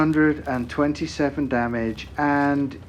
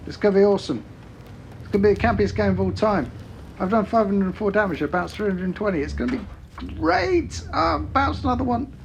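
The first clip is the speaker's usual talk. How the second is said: high, fast gloating; gleeful; 6 semitones higher than usual. Retold or imitated. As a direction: crow about the campiest game. Gleeful retold taunt to teammate.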